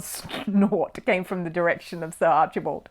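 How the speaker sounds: ordinary delivery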